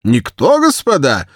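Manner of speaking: слегка сыгранный толстый офицер, громче и выше; барин-рассказчик напористо вопрошает компанию; штаб-офицер басом. A narrator's reading. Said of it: "спросил штаб-офицерский бас". (лёгкий намёк на густой офицерский бас)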